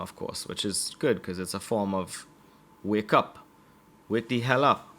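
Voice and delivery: ordinary talk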